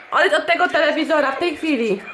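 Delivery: scolding. Real, not acted. Mother scolds child at TV.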